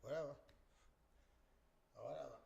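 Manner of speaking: dismissive. Retold or imitated. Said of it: "That's what Franchise said".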